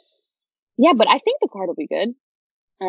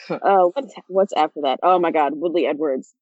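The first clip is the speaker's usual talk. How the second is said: ordinary delivery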